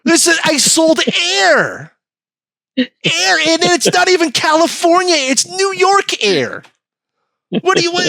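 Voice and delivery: high, loud mock shout; furious retort. Seller yelling at California over taxed air, high and loud. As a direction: yell it at them, high-pitched and slow. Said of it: "It's like".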